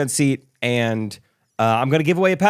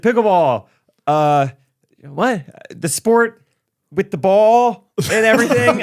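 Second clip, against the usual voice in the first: high, louder pleading explanation; exasperated explanation; 6 semitones higher, 4 dB louder, slower than usual. Retold or imitated. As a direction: spell it out to someone clueless. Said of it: "I said".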